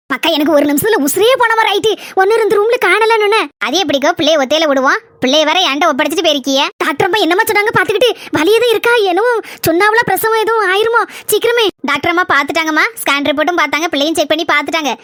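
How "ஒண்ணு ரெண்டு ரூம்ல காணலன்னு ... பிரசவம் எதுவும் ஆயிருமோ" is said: usual speaking voice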